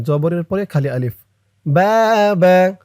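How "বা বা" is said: loud chanted "বা" with a held vowel; one-alif stretch. Shown as a demonstration. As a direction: project and draw out "বা". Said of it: "এক আলিফ টান". ("বা" জোরে এক আলিফ টেনে)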